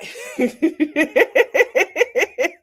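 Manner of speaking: long, high, real giggling fit; laughing. Real, not acted. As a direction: break up laughing mid-thought. Cracks up mid-sentence.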